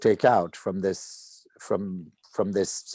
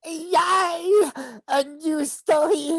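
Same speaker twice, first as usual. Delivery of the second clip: put-on very high, delighted child voice; child thrilled about a new story; excited child; 4 dB louder, much slower than usual. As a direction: squeal with joy like a little kid. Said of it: "playing the child here".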